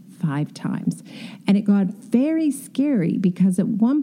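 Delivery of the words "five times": "Five times" dropped much softer and lower; rueful quiet repeat. Real, not acted. Designer repeats the count of broken slabs to herself, quiet and rueful. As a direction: let "Five times" sink, rueful and soft.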